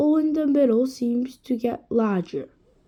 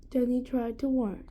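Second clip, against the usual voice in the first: usual speaking voice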